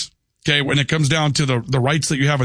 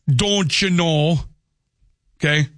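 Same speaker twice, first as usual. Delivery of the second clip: lightly drawn-out Upper Midwest accent; regional accent. Shown as a demonstration. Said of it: "there's an accent everywhere".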